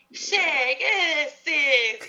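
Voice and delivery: drawn-out, sing-song fake Argentine accent; mock Argentine accent, Italian-sounding. Mocking people who imitate Argentines, overdone Italian-like Argentine accent. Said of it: "Y parecen italianos hablando".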